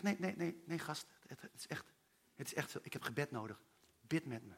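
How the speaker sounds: low, almost whispered asking; soft urgent plea. Retold or imitated. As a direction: quietly insist it's too much. Eerlijk om gebed vragen. (zacht, bijna fluisterend smekend)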